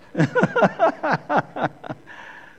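high, loud burst of laughter; real high-pitched laughing fit. Real, not acted. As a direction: break into a long, real cackle. Speaker laughs at phone aside.

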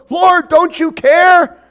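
high, loud, drawn-out cry; panicked plea. Retold or imitated. Disciples crying out to Jesus in the storm, panicked. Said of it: "panic mode".

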